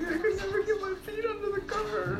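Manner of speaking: whiny complaint. Retold or imitated. Friend acts out being too high to pull up the blanket, whiny. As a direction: whine like a helpless stoner. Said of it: "I'd be like".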